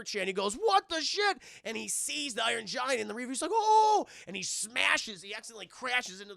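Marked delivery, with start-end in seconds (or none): 0.5-1.4 high, loud mock outcry; shocked yell. Man shocked, retold. 3.5-4.1 high, loud mock scream; alarmed scream. Man screams before crash.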